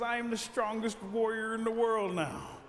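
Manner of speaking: high, ringing mock-heroic boast; puffed-up warrior declaration; boastful proclamation. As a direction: announce yourself champion to the world. Turtle proclaims himself warrior.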